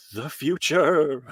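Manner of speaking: drawn-out, theatrical mock announcement; hammed-up futuristic wonder, laughing after; mock sci-fi grandeur. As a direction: stretch it out like a silly sci-fi trailer.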